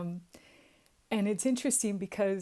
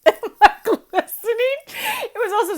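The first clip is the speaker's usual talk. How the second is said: laughing; 12 dB louder than usual. Real, not acted. Laughing recalling the Zoom call.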